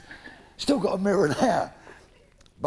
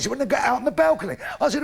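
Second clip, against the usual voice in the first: normal talking voice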